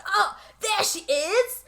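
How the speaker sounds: high, slow fake Cockney; drawn-out bad Cockney flourish; crazy British accent. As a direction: announce her in a hammed-up British voice. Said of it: "crazy British accent".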